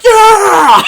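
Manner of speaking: huge high whooping cheer; crowd cheer. Acted. Pirates cheering back at the captain, shouting. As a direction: cheer like a whole mob. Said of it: "And they all like".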